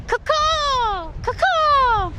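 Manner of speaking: high, ringing falling caw; high pretend bird cry; seagull call. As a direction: squawk it out like a seagull. Practising seagull mating call.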